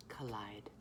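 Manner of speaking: low, soft whisper; whispered, falling away; hushed last word. Real, not acted. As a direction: drop to a whisper to close the lyric. Last word of emotional lyric reading.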